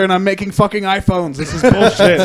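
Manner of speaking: mock worker's complaint. Acting out a factory worker complaining.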